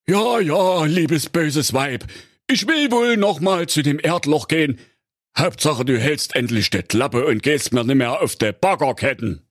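high put-on husband voice, resigned; weary husband. A narrator's reading. Husband giving in to his nagging wife, high resigned voice. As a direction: give in to her with a sigh in his voice.

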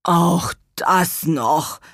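very slow, drawn-out groan of dismay. A narrator's reading. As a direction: groan each word at this new trouble. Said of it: "stöhnte Gurkenhut".

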